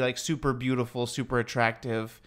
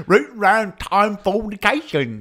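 slow, higher, louder British caricature; mock British accent; 8 semitones higher, 7 dB louder, slower than usual. Host continuing the joke in a slow mock British accent. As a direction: stretch out a goofy British voice.

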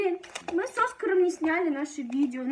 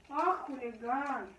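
slow, softer fond scolding; 3 semitones lower, 6 dB quieter, slower than usual. Real, not acted. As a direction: tease the rascal lovingly. Fond teasing of the dog.

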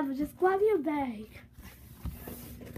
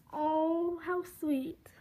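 usual speaking voice

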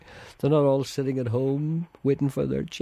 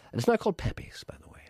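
unvoiced whisper, slightly higher; whispered aside; 10 semitones higher than usual. Real, not acted.

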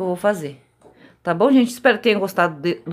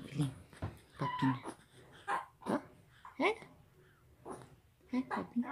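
normal talking voice

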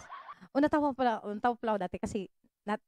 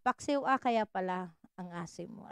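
slower, much softer punchline; deadpan punchline; 7 semitones lower, 6 dB quieter than usual. Pretend. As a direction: drop the joke quietly. (mahina at mabagal na banat)

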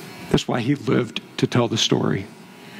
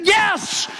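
retold furious shout. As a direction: yell it back as the enraged attackers. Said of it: "they said".

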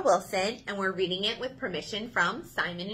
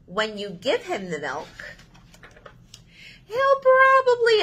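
lively picture book reading; reading to children; 6 semitones higher, 4 dB louder than usual. Acted. Librarian reading If You Give a Mouse a Cookie to children, expressive. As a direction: read it with big expressive turns for kids.

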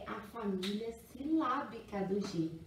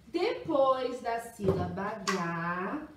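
ordinary talk

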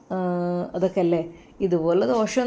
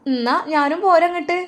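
put-on much higher, louder old mother; mother's voice; 10 semitones higher, 5 dB louder than usual. Mother offers to come along with son, eager motherly voice.

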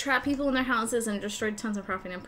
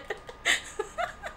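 high snorting laugh; 4 dB quieter than usual. Real, not acted. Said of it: "I legit snorted".

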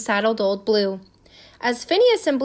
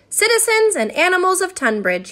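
raised, higher proclaiming voice; public announcement; 7 semitones higher, 4 dB louder than usual. A narrator's reading. Phineas addressing the citizens and animals of Tunbridge, announcing. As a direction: address the whole town. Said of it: "he announced".

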